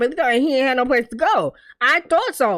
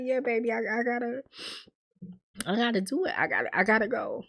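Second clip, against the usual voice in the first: hushed, halting, understated sorrow; retold tearful goodbye; 9 dB quieter, faster than usual. Man saying goodbye to his girl, quietly tearful. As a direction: imitate him softly struggling to say it. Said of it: "because he was crying".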